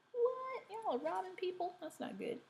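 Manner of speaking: high, soft squeal of disbelief; hushed pretend astonishment; mock shock. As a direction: play-act being scandalised, in a small high voice. Mock shock at the robbery plot.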